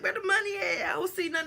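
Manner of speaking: put-on alarmed little kid; frantic kid search. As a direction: call out searching for the money. Searching pillows for money.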